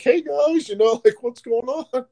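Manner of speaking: innocent Sean. Retold or imitated. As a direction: play dumb and chipper with the gang. Sean innocently greeting the gang.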